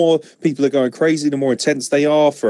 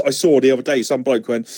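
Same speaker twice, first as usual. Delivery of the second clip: ordinary talk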